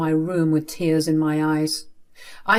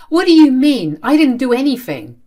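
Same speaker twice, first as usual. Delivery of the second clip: loud, high-pitched cry of innocence; indignant protest; 7 semitones higher, 9 dB louder than usual. Acted. Child accused of costing a job, indignant protest.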